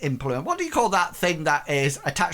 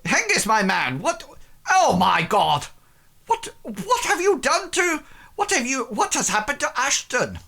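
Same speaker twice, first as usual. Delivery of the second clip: very high alarmed questioning; shocked noble; 11 semitones higher, 3 dB louder than usual. Acted. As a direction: demand what happened in horror. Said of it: "and says".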